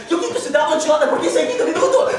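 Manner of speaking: high, loud burlesque Spanish; mock Spanish. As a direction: imitate an agitated Spanish speaker. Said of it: "The strange young man says in Spanish".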